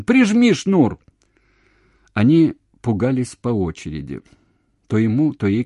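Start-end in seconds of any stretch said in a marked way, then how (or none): 0.0-1.0 very high, loud order; shout. A narrator's reading. Man telling her to fix the cord, shouting. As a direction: yell urgently into the phone. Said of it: "кричал он".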